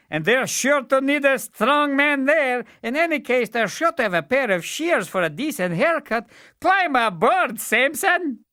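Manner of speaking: high, loud jolly voice; the fool. Acted. Fool inviting the weakling aboard, high and loud. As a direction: joke and wave him aboard. Fool's voice.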